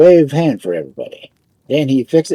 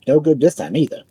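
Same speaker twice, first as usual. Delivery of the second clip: ordinary delivery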